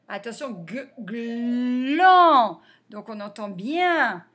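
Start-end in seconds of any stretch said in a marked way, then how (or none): 0.7-2.6 a lone "g", then a long, loud "gland"; sounded out. Shown as a demonstration. Teacher warning pupils to listen, "g-, gland" sounded out. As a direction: stretch the "l" and vowel of "gland".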